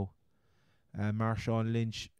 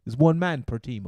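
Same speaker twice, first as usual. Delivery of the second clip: mock stern telling-off; 4 semitones higher, 9 dB louder than usual. Jokingly scolding co-host on advice.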